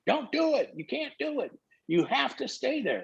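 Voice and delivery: high, hushed, trembling retold plea; imitating a shaken old coach begging him not to go; shaking plea. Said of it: "he's shaking".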